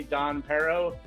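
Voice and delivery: ordinary talk, nothing marked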